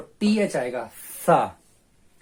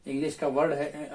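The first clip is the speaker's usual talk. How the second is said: normal talking voice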